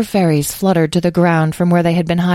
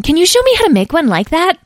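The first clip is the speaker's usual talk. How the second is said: very high, loud, eager plea; eager little voice, pitched way up; gushing; 6 dB louder than usual. A narrator's reading. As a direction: ask in keen admiration. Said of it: "gushed Edwin".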